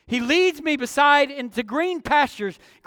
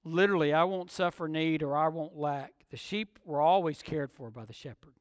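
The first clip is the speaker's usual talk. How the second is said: soft, low-pitched explanation; quiet, low sermon aside; hushed preaching aside; 7 semitones lower, 8 dB quieter than usual. Real, not acted. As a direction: drop the voice well down from the pulpit pitch.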